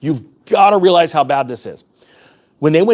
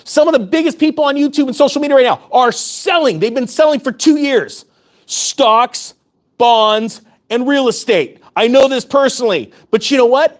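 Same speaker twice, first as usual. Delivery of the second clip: pitched higher and driving hard, real heat; fired-up rant; 6 semitones higher than usual. Real, not acted. YouTuber telling viewers the media lies, fired-up higher rant. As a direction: rail against the lies with rising fire.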